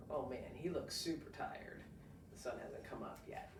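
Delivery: ordinary delivery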